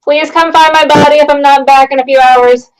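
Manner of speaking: ordinary talk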